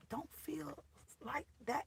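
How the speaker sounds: sudden hushed whisper. Real, not acted. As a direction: drop it to a breath, conspiratorial. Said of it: "dropped to whisper mid-question".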